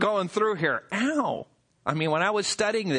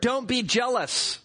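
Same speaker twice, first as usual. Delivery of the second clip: ordinary talk, nothing marked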